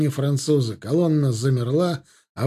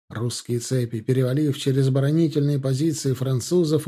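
plain reading voice

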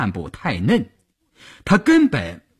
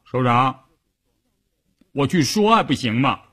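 pouting. Acted. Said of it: "嘟着嘴说".

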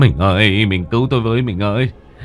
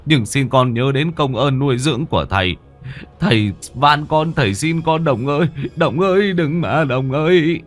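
pleading that climbs higher and higher; desperate begging; 5 semitones higher than usual. A narrator's reading. Father clutching his son's legs for mercy, desperately pleading. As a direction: beg for your life, voice rising into a wail. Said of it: "ôm lấy chân con mà van xin".

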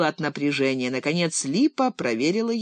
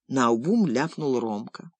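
usual speaking voice

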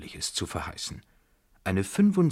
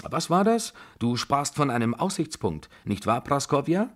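put-on energetic, pitched-up old matriarch; brisk Babuschka; 3 semitones higher than usual. Babuschka planning her sightseeing, brisk and bossy. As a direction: brush off concern and take charge.

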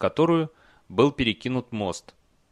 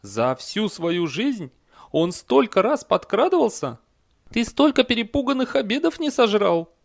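high, louder horse voice rising higher; horse vouching for rider; 11 semitones higher, 5 dB louder than usual. A narrator's reading. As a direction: lay it on thick in a high horse voice. Horse boasting about Cohen to troll. (высокий напористый голос лошади)

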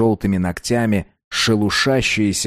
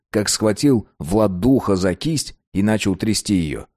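plain reading voice

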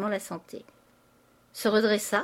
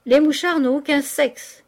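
loud, higher jeer; heckling retort; 6 semitones higher, 7 dB louder than usual. A narrator's reading. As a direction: throw it back loudly at him. Painter heckles Poiret.

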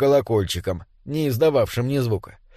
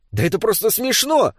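high-pitched indignant protest; 7 semitones higher than usual. A narrator's reading. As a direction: protest at the absurdity. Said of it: "возмутился Дэвид". (возмущённо)

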